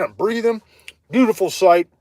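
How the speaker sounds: ordinary talk, nothing marked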